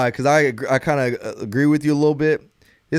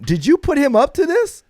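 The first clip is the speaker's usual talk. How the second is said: high, incredulous accusation; a raised, suspicious demand; retold suspicion; 12 semitones higher than usual. Father accusing his son of a scheme, high and incredulous.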